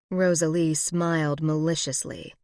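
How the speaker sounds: ordinary delivery, nothing marked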